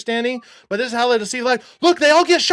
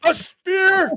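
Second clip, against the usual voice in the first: excited answer; 5 semitones higher than usual. Real, not acted.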